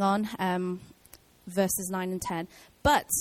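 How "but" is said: "But" set apart with a high pitch, then a pause; emphatic BUT. Real, not acted. Reading verses aloud to listeners, emphatic "But". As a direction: land hard on "But" and let it hang. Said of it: "big but there".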